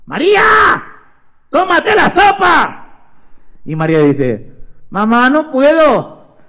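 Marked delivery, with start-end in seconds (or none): 0.1-2.8 high, drawn-out shout; mother's calling voice. Acted. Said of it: "se escucha una voz que dice". 4.9-6.4 high, slow, drawn-out plea; stretched-out high complaint; child's whiny protest. Acted. María protests to her mother.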